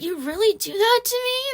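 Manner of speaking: high, whiny disbelief. Retold or imitated. Ex whining after she calls his bluff, high whiny disbelief. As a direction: play the wounded boyfriend. Ex whining about leaked images.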